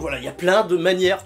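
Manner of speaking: normal talking voice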